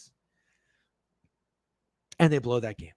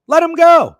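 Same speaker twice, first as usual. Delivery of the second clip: loud furious shout; exasperated shout at the team; angry yell; 14 semitones higher, 14 dB louder than usual. Real, not acted. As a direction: yell it in disbelief. Angry rant about team re-signing.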